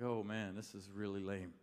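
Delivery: low, quiet grumble to himself; deflated aside. Retold or imitated. Preacher privately reacting to God's short message, deflated aside. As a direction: drop right down, unimpressed.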